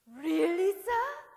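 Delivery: loud, high, eager disbelief; thrilled. Acted. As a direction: jump at the chance with delight. Teddy thrilled to fight an enemy.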